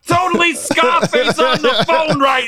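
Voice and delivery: very high, slow, shouted puppet voice; squeaky ventriloquist dummy. Retold or imitated. Mocking the ventriloquist's megaphone.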